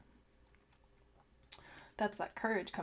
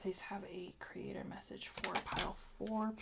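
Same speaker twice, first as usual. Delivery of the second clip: whispered; 7 dB quieter than usual. Real, not acted. Asking cards softly.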